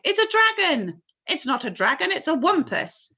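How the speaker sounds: high loud overlapping guesses; frightened shouts. A narrator's reading. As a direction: shout each guess in alarm. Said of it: "all started shouting at once".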